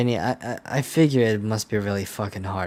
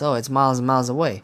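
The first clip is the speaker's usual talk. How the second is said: fast, loud, higher mock imitation; mocking quote; 5 semitones higher, 6 dB louder, faster than usual. Player mimicking the character's late reveal, mocking. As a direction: parrot it back in exasperated mockery. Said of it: "this motherfucker says".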